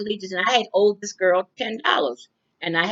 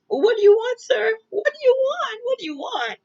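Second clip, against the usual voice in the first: trembling voice. Retold or imitated. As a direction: replay the panic, voice jumping up high. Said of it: "I asked in a trembling voice".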